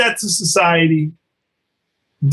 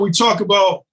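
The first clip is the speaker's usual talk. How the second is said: ordinary talk, nothing marked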